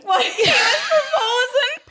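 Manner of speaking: high laughing squeal; laughing shout. Real, not acted. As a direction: burst out laughing on the word. Laughing at own story climax.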